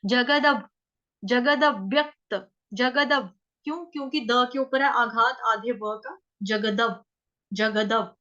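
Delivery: जगदव with a full द joined to व, then a slight pause. Shown as a demonstration. Teacher drills the joined द before व for students. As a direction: say जगदव, not जगत, a little break after. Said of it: "द के बाद हमने व बोलना है".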